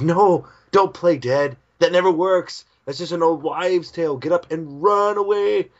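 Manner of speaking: high, loud frantic warning; panicked cartoon shouting; urgent yell. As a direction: yell at your friends to save themselves. Shinpachi urgently warns the others.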